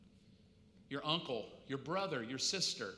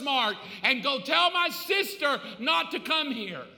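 loud, higher, furious scolding; angry yelling; 5 semitones higher, 4 dB louder than usual. Acted. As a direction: tell him off for whining about his church. Dead relative yelling at preacher.

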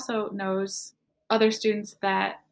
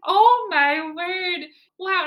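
high, slow, louder gasp of shock; stunned disbelief; 10 semitones higher, 9 dB louder, much slower than usual. Retold or imitated. Telling a friend her reaction to his confession, slow stunned disbelief. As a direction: drag out the shock as if you can't believe it. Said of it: "I was like".

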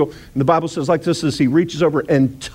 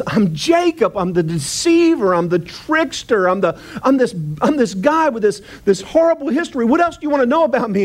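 climbing higher and faster into exasperation; anguished Jacob; 8 semitones higher than usual. Retold or imitated. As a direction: spill out every shameful name. Jacob confessing, rising agitation.

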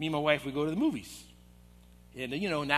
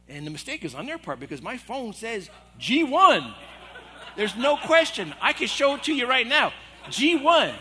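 louder and higher, laughing through it; mock indignation; 5 semitones higher, 7 dB louder than usual. Pastor replaying his indignant case to the congregation, laughing and loud. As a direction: protest your seat number with mock outrage.

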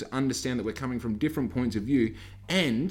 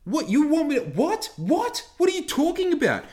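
much higher, louder incredulous whats; mock disbelief; 5 dB louder than usual. Mocking a customer's vaccine advice, high incredulous squeal. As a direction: squeal your confusion.

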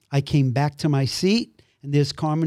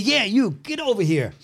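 high-pitched summons, only mildly raised volume; imitated calling out; 7 semitones higher than usual. Pastor to the man ignoring him, high insistent mock call.

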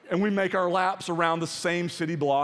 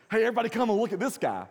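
mock excited call; 8 semitones higher, 4 dB louder, much faster than usual.

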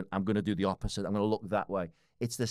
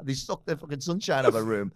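laughing; 6 semitones higher, 5 dB louder than usual. Real, not acted. Still laughing after the joke.

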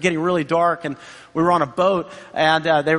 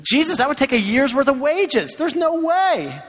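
higher, mock disbelief, slowing at the end; exasperated disciples; 7 semitones higher than usual. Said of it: "Like, uh".

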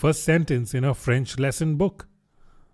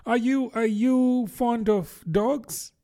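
high, halting question, drawn out; hesitant, pitched-up Alice voice; changing the subject; 10 semitones higher, slower than usual. A narrator's reading. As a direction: grope slowly for a safer topic. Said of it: "in a great hurry to change the subject".

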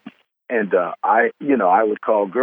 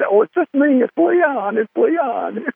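put-on much higher, small boyish voice owning up; sheepish kid voice; 3 dB louder, slower than usual. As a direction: squeak it out like a caught teenager. Retold himself, trash can off.

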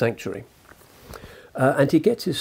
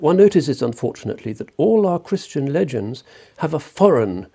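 put-on loud quoted reading, no accent; 3 semitones higher, 7 dB louder than usual. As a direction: proclaim the quote grandly, in plain English. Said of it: "I won't do the German accent".

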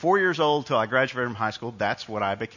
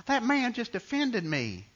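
high, slow cartoonish Southern mom; heavy Mississippi accent; 12 semitones higher, slower than usual. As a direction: imitate a tearful Southern mother with a drawn-out Mississippi twang. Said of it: "Southern Mississippi accent".

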